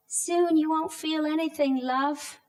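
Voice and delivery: a hint of a syrupy nurse; stranger's soothing voice. A voice during anaesthesia.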